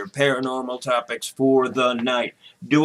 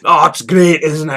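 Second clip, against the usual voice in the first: put-on Scottish accent; 5 dB louder than usual. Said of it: "awful accent".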